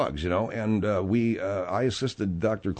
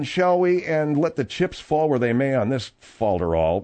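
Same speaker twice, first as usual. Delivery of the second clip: usual speaking voice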